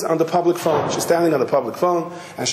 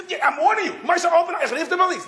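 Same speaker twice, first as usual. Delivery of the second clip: high, sharp warning shout; threatening yell; faster than usual. Retold or imitated. Wife threatens husband through door, high warning yell. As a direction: threaten to call the police.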